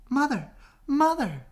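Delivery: very high, loud calling; excited calling. Acted. Young swans calling to their mother, very high and excited. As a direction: call out to mother in delight. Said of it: "his new friends cried".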